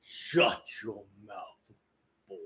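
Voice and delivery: slow, low stern command; Kratos. Acted. Kratos silences the boy, slow low Kratos voice. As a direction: draw it out deep and restrained. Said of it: "Kratos very well".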